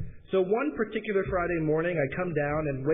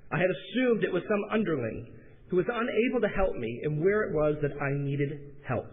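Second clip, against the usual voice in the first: ordinary delivery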